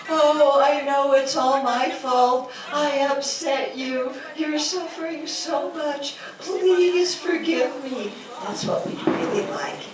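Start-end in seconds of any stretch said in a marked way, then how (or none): 0.1-8.1 high, loud, hammed-up begging; groveling apology. Imagined partner groveling for forgiveness, hammed-up and loud. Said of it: "come groveling on the floor".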